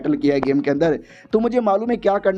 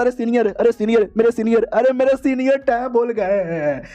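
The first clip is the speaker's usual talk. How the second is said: hammed-up wailing junior; 6 semitones higher than usual. Junior wailing that his senior has died, high and hammed-up. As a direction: wail over your fallen senior, overdone.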